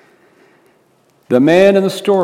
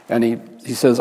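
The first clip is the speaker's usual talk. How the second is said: ordinary talk, nothing marked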